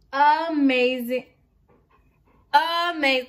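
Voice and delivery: loud, drawn-out delight; a loud happy cheer over her subscriber count; excited. Real, not acted. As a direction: stretch it out, thrilled. Celebrating four thousand subscribers.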